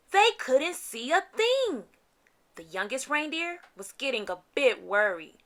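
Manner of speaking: plain reading voice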